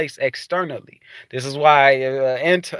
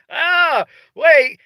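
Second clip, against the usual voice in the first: startled; 10 semitones higher than usual. Retold or imitated. Startled reply to the Watchers.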